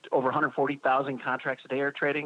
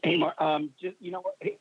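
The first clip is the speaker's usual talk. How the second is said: inside concrete bunker; 4 semitones higher than usual. Real, not acted. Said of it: "You sound like you're in a bunker somewhere".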